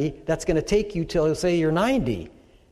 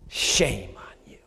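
much lower, slow, disapproving voice of God; stern God; 9 semitones lower, 3 dB quieter, slower than usual. Pretend. God rebuking a believer in eternity, low and slow. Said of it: "God's not gonna come to you one day in eternity and say".

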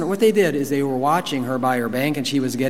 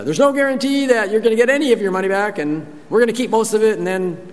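fast, higher mock fine-print voice; mock disclaimer; 7 semitones higher, faster than usual. Mocking the investment company's fine print, rattled off fast. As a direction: rattle it off like a sneaky ad.

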